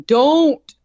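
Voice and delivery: put-on dad trying to stop the boyfriend; dad shouting. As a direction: shout a quick warning. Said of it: "my dad's trying to scream at him".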